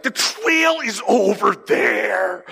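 high, drawn-out cry of discovery; excited calling out. Retold or imitated. Lost hunter points his buddy to the trail, calling out. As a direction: stretch it out high, calling across the woods.

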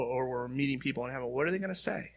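ordinary delivery

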